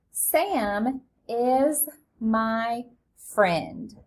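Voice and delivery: very slow, each word drawn out; teacher reading the sentence slowly aloud; slow model reading. Shown as a demonstration. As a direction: read it out slowly for beginners. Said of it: "Read it with me".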